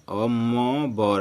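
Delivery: मो बर split apart and drawn out slowly; slow syllable split. Shown as a demonstration. Teacher splitting अंबर into syllables for the class, slow. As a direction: stretch मो and बर apart. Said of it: "अनुस्वार का मो आया".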